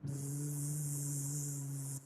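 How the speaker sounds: low, soft buzzing like a bee. Shown as a demonstration. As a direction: buzz it out like a bee flying around. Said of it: "what sound it makes?".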